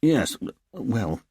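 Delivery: flustered, stalling start; blustering. Acted. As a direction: bluster through embarrassment. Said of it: "he blustered".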